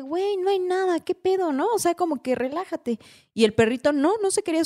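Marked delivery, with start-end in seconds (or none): 0.0-3.0 higher, half-laughing dismissal of the dog's fear; exasperated reassurance. Retold or imitated. Girl telling her nervous dachshund to relax, in a high, exasperated voice. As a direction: brush it off in a high voice.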